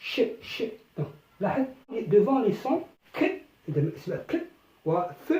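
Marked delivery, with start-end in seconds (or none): 0.0-0.6 whispered ch, ch; ch sound demo. Shown as a demonstration. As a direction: hiss the ch sound softly. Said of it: "ننطقها". (صوت ش مهموس)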